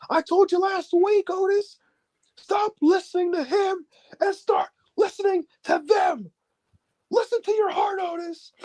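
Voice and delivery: high, earnest mock pleading; impassioned plea. Sami pleading with Otis in the ring, high earnest plea. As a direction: beg him to listen to his heart. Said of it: "he said".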